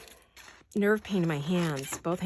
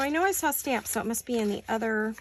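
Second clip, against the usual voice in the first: ordinary talk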